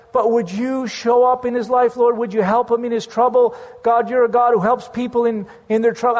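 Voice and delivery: earnest intercession; fervent prayer. Retold or imitated. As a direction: plead with the Lord for the man. Retells his fervent prayer.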